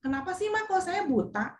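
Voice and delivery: quiet imitation of a questioning child; questioning teenager. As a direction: lightly voice a teen's plaintive question. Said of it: "yang udah A B G misalnya".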